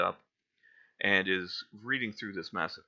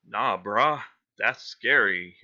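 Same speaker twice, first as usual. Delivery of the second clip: loud scared bro whine; nervous dude, loud; frat bro; 11 dB louder, slower than usual. Acted. Scared teen bro replies.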